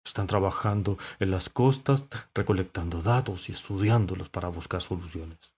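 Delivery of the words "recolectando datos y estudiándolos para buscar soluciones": plain reading voice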